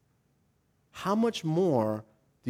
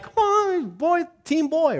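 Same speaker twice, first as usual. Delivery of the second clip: mock cheering wife; 14 semitones higher, slower than usual. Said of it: "she wanted to be like".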